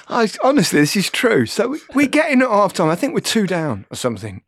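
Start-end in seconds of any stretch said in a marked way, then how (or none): none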